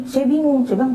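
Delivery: usual speaking voice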